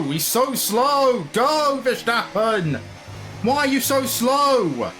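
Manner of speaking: high, drawn-out cry of frustration; exasperated outburst. Real, not acted. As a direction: wail at the driver ahead, stretching each word. Venting at slow car ahead.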